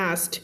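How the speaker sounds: "asked" without the K; K dropped. Shown as a demonstration. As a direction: say "asked" as "ast". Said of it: "ตัด K ทิ้ง".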